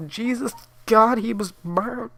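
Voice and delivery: high, slow, strained wail; a mother horrified at her burned son; anguished cry. Acted. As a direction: cry out in horror. Mother breaks down over son.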